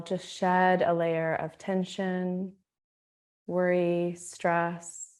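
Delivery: unhurried, hushed calm, letting each phrase settle; guided meditation. Acted. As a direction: guide them gently into stillness, leaving space after each phrase.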